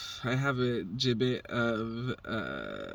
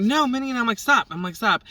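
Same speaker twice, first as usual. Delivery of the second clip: high, loud command; shouting at the dog; 9 semitones higher, 6 dB louder than usual. Retold or imitated. Ordering Minnie off the kitten, loud high shout. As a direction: yell at the dog to stop.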